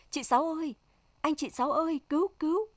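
high-pitched panicked calling, voiced in narration; cry for help. A narrator's reading. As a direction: call urgently to the neighbours for rescue. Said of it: "Loan la lên".

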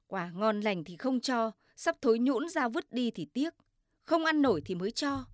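plain reading voice

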